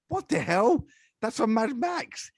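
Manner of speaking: high-pitched astonished outburst; retold amazement. Podcaster retelling the moment they recognised a game sound, high amazed exclamation. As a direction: exclaim it high in mock disbelief.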